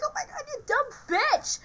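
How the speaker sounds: high, laughing mock-parrot voice; mimicked parrot squawk. Parrot copying parents fighting, high mimicking squawk. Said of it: "the parrot just listens in and copies that".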